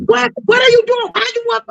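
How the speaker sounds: very high-pitched startled questioning; retold alarmed demand. Acted startled confrontation with the man.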